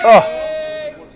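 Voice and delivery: sharp, high startled cry; surprised shout. Real, not acted. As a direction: gasp out loud at the lunge. Sudden lunge.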